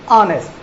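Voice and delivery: ऑनेस्ट opening on the vowel, no H; silent H. Shown as a demonstration. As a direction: start ऑनेस्ट on "aw", dropping the H. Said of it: "इनका pronounce किया जाता है".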